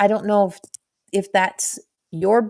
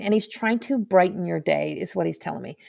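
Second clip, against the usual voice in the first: ordinary delivery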